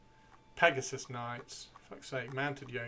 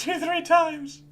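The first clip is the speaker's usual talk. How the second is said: laughing; 13 dB louder, slower than usual. Real, not acted. Laughing peak after joke.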